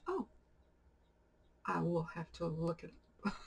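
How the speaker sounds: imitating a deadpan, noncommittal reply; soft, straight-faced mock politeness; poker-faced. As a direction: quietly deliver it with a poker face. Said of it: "keeping a straight poker face".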